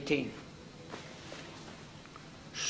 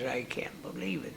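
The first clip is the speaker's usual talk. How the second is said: hushed, breathless whisper of disbelief; tearful whisper; 3 semitones lower, 10 dB quieter, faster than usual. Retold or imitated. Father whispering through tears over his son's pay. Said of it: "he started to cry".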